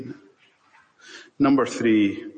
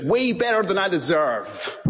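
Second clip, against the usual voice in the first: a hint of a bright Alabama server, pitched higher; lightly voiced cheerful barista; 7 semitones higher than usual. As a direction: pitch it up a touch for the cheerful woman. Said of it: "She says".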